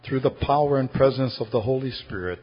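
soft, low, measured praying voice; closing prayer. Real, not acted. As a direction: pray gently, pausing between phrases, sinking to amen. Leads congregation in closing prayer.

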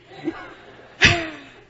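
high burst of laughter; laughing. Real, not acted. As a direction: laugh out loud in disbelief. Laughing at the journal.

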